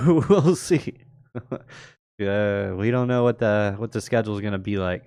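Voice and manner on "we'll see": laughed out over a chuckle, higher and louder; amused, giggling repeat; laughing. Real, not acted.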